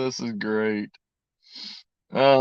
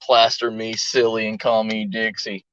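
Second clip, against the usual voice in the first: ordinary talk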